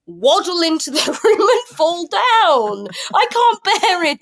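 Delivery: high, drawn-out cry of disbelief; comic exasperation. Real, not acted. Fan despairing at Charlotte's storyline, comically exasperated. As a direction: let the voice soar in exasperation.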